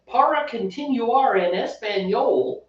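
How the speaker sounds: hammed-up American accent on Spanish. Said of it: "the same girl".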